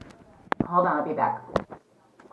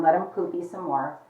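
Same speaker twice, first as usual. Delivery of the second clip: ordinary talk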